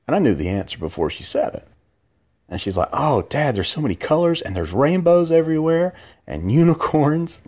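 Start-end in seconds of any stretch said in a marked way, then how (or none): 2.9-7.4 put-on high, bubbly little girl gushing; excited little girl. Dad imitating his daughter gushing about the parade, high and excited. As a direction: gush about rainbows like a ten-year-old.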